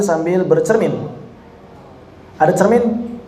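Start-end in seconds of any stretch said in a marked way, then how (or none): none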